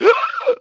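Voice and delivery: sharp high shocked gasp; mock gasp. Fan hearing the news, mock gasp.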